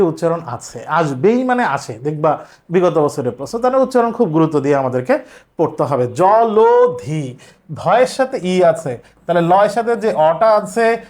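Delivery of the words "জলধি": জলধি said slowly as "জলোধি", the ল with an o sound; correct pronunciation. Shown as a demonstration. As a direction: stretch জলধি out syllable by syllable, জ-লো-ধি. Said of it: "এই অটার উচ্চারণ হচ্ছে ও এর মতো হবে". (ধীরে ধীরে জলধি, ল-এ ও-কার দিয়ে)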